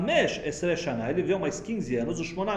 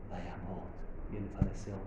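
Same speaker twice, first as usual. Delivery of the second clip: soft, much quieter, the words sinking away; hushed reading; 10 semitones lower, 10 dB quieter than usual. Shown as a demonstration. Rebbe reading the death verse, soft and hushed. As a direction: let the ending die down softly. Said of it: "Como se lê uma paraxá".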